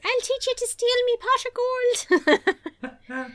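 high, slow hammed-up Irish fairy; mock Irish threat. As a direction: threaten like a cartoon leprechaun.

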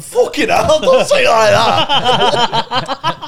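high loud laughing exclamation; laughing outburst. Retold or imitated. Comedian hearing the score from staff, high laughing yell. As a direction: burst out laughing in shock. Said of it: "I went".

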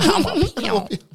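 high, slow laughing echo. Real, not acted.

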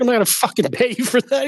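high, fast, hammed-up angry outburst; mock furious rant. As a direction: spit it out in outrage. Acted angry movie quote.

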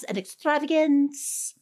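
a light touch of a refined Austen narrator, slow; Jane Austen narrator. Retold or imitated. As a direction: savour it like a period drama narrator. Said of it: "narrating a Jane Austen novel".

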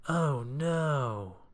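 long, appalled groan of dismay; aghast dismay. A narrator's reading. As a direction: wail in mock horror. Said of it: "he gasped".

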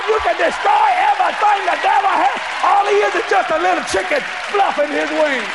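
high, ringing sermon delivery; full-force preaching. Real, not acted. Preacher telling believers the devil is beaten, fired up. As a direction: drive the moral home to the crowd.

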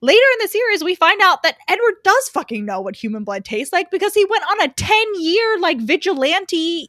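loud, high, fast indignant outburst; excited rant. Real, not acted. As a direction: blurt it out all worked up. Excited rant about Edward.

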